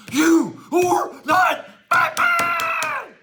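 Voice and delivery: high, loud, slow yelling; a full-on tantrum shout; furious shout. Acted. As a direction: scream it in a rage. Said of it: "Dude, you need to chill".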